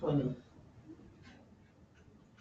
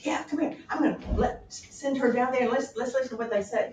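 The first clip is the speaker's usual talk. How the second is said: scheming; 8 semitones higher, 6 dB louder than usual. Acted. Polonius plotting with king.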